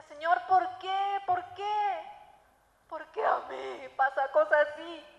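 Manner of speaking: mock-wailing, stretched question; retold tearful plea. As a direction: reenact pleading with God in tears. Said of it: "Y lloraba, lloraba, lloraba, yo le decía".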